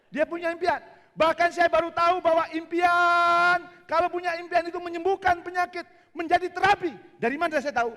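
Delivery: fervent preacher's cry; preaching climax. Real, not acted. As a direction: declare it to the hall. Preaching point at full force.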